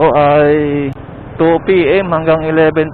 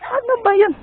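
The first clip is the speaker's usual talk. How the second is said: high cutesy voice. Acted. Same high voice.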